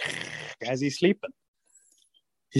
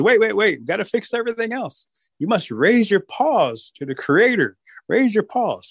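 bossy Rabbit; 7 semitones higher than usual. Acted. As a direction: teach them like a trickster. Rabbit instructs wolves.

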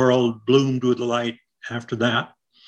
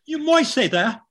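Norwich accent; 11 semitones higher than usual. Shown as a demonstration. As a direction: lift the Norfolk accent up high and sing it out. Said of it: "the Norwich accent".

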